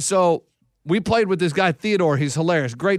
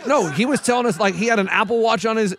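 ordinary talk

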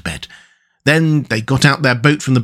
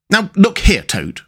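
sharp; 8 semitones higher than usual. A narrator's reading. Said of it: "said the Rat sharply".

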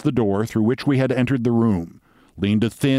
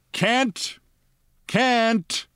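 yelling brakeman; 12 semitones higher than usual. A narrator's reading. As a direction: call the stop like a brakeman. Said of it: "a brakeman was yelling".

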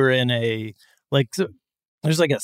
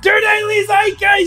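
shouted intro; 6 dB louder than usual. Acted.

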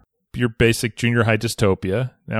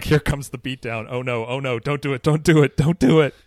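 mock nervous; 3 semitones higher than usual. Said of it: "You're just like".

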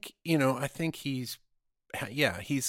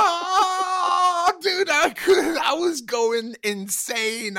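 much higher, loud exasperated cry; outraged shout; 11 dB louder, much slower than usual. Retold or imitated. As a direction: cry out in disbelief. Retelling his outrage at the guy.

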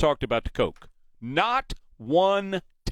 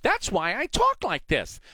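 mock kindergarten teacher; 9 semitones higher than usual. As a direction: talk down to them like one-year-olds. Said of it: "She sounds like a Sesame Street host".

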